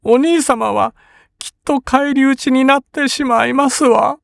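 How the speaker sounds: crying sister. A narrator's reading. Kin crying to brother.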